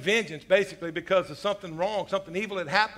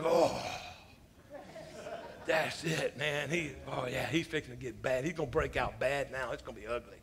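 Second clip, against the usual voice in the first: much lower, softer ominous movie voice; tough guy movie line; 6 semitones lower, 8 dB quieter than usual. Retold or imitated. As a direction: growl it like an action hero. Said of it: "they say something like".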